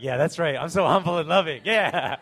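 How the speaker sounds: loud, high, cocky hammed-up agreement; mock boastful youth. Preacher imitating self-satisfied youth in his congregation, mock boasting. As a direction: crow it proudly, breaking into a laugh. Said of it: "You're like".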